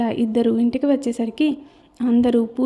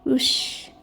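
a soft unvoiced shush; 6 dB quieter, much slower than usual. Girl hushing two noisy women at the puja, whispered shush. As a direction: hush them with a finger to the lips. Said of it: "note మీద వేలు పెట్టి".

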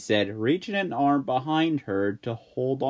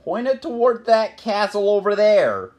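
higher, louder request; Jack voice, urgent; 8 semitones higher, 7 dB louder than usual. Acted. Jack directing May to the castle. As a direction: press her to steer.

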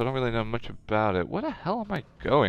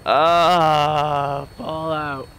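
long higher 'ah', then easing off; exasperated cry; 3 semitones higher, 4 dB louder, much slower than usual. Real, not acted. Gamer lamenting a frozen Fallout, exasperated. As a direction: groan out loud at the game.

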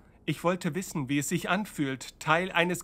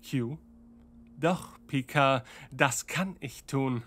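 ordinary delivery

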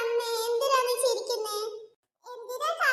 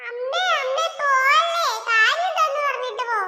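calling after mother; 2 semitones higher, 6 dB louder than usual. Acted. Son calls after his leaving mother, loud and pleading. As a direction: shout after her not to go.

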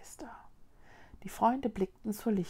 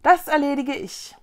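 eager, raised, louder promise; confident Vicky; 6 semitones higher, 7 dB louder than usual. Acted. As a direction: brim with can-do confidence. Confident child character promise.

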